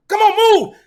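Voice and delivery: high, loud urgent yell; dad hollering at his son to hurry up. Retold or imitated. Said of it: "this is my exact tone".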